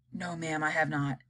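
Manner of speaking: low, soft nervous reply; cowed spy. Acted. Chief spy answers Miss Tibbs, low and cowed. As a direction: shrink and answer meekly. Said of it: "said the chief spy".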